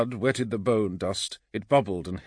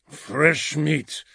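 rumbled; 5 semitones higher than usual. A narrator's reading. Orto eyes the kill, thirsty rumble. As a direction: rumble it out hungrily. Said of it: "rumbled Orto".

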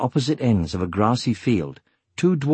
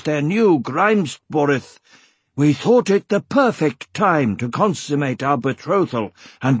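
gruff dwarf voice, pitched up; 5 semitones higher than usual. A narrator's reading. Dwarf husband explains why they married now, gruff. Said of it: "continued in his gruff manner".

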